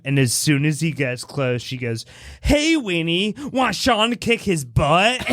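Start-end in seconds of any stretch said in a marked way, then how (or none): 2.4-5.2 higher-pitched kid's taunt, lightly acted; mock taunt. Retelling a little girl goading a bully, mock taunting. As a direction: call it out like a bratty little sister.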